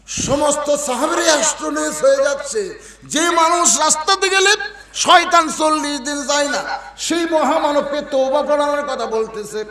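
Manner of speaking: very high, loud sermon chant; full-force preaching. A narrator's reading. Preacher recounting the companions' astonishment, full-force preaching. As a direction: build to a ringing, high-pitched shout.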